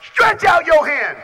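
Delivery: slow, high, loud command; Jesus' command shouted. Retold or imitated. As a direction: proclaim it over the crowd.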